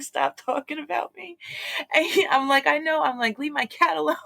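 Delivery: high-pitched, worked-up retelling; high indignant quote with a laughing edge; retold confrontation. Retold confrontation with neighbour boy.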